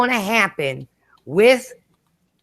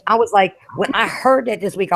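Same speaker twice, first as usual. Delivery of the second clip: usual speaking voice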